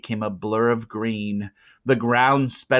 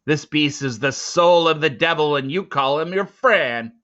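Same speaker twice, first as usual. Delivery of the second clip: louder scornful sneer; 5 semitones higher, 7 dB louder than usual. Acted. Big man scorning Teo's friendship, loud sneer. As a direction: scoff at the boy's claim. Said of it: "he sneered".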